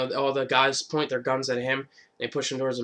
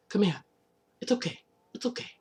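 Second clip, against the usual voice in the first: higher, fading to soft coaxing; soothing; 8 semitones higher, 4 dB quieter than usual. Retold or imitated. Michonne coaxing a little girl out of a cage, softly. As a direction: coax a scared child gently.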